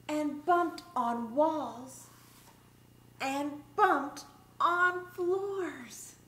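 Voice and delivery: lively, singsong picture-book read-aloud; reading to children. Acted. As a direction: read it like story time for little kids.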